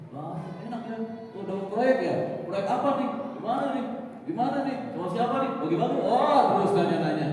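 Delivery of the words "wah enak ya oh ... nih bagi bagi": 1 nosy neighbor. Acted.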